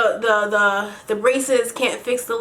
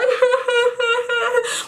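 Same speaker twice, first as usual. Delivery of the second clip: high-pitched mock sobs; imitated sobbing; 11 semitones higher than usual. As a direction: act out bawling in a string of high sobs. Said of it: "I'm literally sobbing in the chair".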